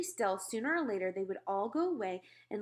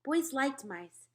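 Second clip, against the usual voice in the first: ordinary delivery, nothing marked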